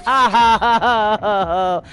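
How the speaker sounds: loud, high burst of laughter fading out; big laugh that trails away. Real, not acted. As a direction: laugh it off after failing. Laughs after dying.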